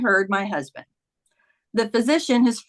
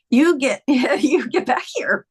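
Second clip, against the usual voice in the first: higher, drawn-out, breathy plea; overcome with emotion; 4 semitones higher, 4 dB louder, slower than usual. Retold or imitated. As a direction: plead through a breaking, breath-caught voice. Recalls calling out to dead husband.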